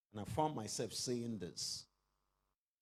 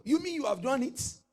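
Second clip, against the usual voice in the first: amazed; 13 semitones higher, 10 dB louder than usual. Pretend. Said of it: "Ask your neighbor".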